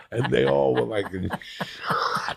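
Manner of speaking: normal talking voice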